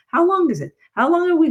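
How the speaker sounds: exasperated question. Real, not acted. Therapist asking society how long grief may last, high and exasperated. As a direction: demand an answer, baffled and pleading. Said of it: "please tell me".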